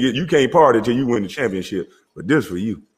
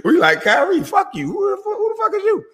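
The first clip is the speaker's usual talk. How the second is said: rising, laughing, squealing put-down, climbing much higher; mock phone tirade; 13 semitones higher than usual. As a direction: cuss him out while cracking up. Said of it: "pick up the phone and say".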